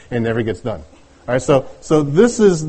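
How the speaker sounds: normal talking voice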